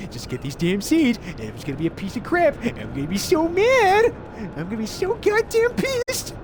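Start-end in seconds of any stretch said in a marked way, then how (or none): 2.6-6.4 mock angry rant. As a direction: blow up in playful outrage. Ranting about reward being bad.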